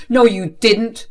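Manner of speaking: loud, slow, flat-out denial; angry denial. Acted. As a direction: cut her off firmly. Father denies being told.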